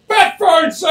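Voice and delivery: loud, high "bat phone"; shouted announcement. Retold or imitated. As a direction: belt it out like a butler. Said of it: "He's like".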